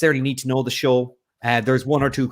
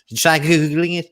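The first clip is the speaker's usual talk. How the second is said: condescending quote; 5 semitones higher, 3 dB louder than usual. Retold or imitated. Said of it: "the person asked me".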